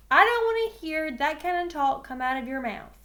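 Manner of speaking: loud, stern scolding; angry coach. A narrator's reading. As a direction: bark it like a mad coach. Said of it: "Coach got mad".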